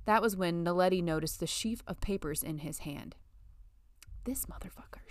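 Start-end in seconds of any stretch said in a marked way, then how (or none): 4.2-5.1 whispered contempt under the breath; whispered curse. Acted. Letty cursing Brian in her head, in a whisper. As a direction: hiss it privately, barely voiced.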